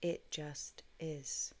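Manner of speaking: very slow, faint whisper; soft divine whisper. Retold or imitated. God's soft voice telling her it just is. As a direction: let it barely breathe out, slow. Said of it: "soft voice".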